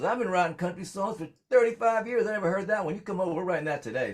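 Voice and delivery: the friend, thrilled, insists you come write it now; excited songwriter. Retold or imitated. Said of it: "He goes".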